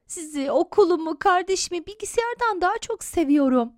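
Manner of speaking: put-on high, bright-hearted child's declaration; joyful. A narrator's reading. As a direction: declare love happily to the family. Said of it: "neşeyle el sallıyor".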